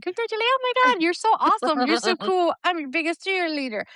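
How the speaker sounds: very high, fast gushing praise; gushing cheerleader. Retold or imitated. Mocking fake cheerleader friends in a high squeal. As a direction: squeal it like an over-the-top cheerleader.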